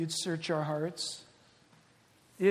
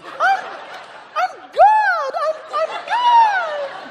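very high, louder mock squeal, slowing at the end; mocking ecstatic cry; 12 dB louder, much slower than usual. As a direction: parody a woman shrieking her divinity. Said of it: "what is this noise I'm hearing".

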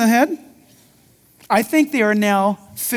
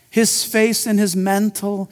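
ordinary talk

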